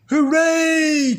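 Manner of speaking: put-on high, loud kids' cheer; cheering shout. A narrator's reading. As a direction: cheer like a happy crowd of kids. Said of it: "cheered her friends".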